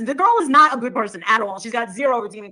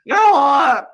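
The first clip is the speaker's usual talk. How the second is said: shouted insult; 8 dB louder, much slower than usual. Real, not acted. Rant ends shouting.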